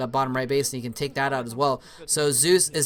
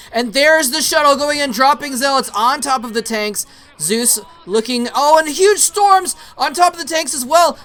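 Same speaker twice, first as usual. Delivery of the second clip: fast, raised esports commentary building with the attack; excited play-by-play; 7 semitones higher, 8 dB louder than usual. Real, not acted. Caster calling a huge attack to viewers, excited play-by-play. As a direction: call the battle louder and higher.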